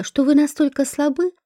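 plain reading voice

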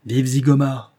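loud rallying cheer; enthusiastic cheer. A narrator's reading. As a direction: hail the gang leader with gusto. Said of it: "crié avec enthousiasme".

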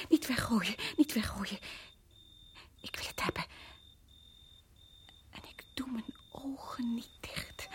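whispered, fixated; cling to it in a whisper; haunted whisper. Acted. Obsessieve fluistering na schok. (bezeten gefluister)

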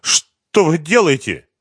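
slow, high startled question; frightened. A narrator's reading. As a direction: ask it in fright, drawn out. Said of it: "спросил испуганно".

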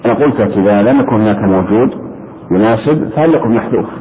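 ordinary delivery

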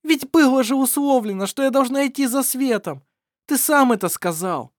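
high, loud protest; indignant. Acted. Shira protesting to Shama, high and indignant. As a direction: protest hotly.

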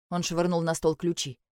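ordinary delivery, nothing marked

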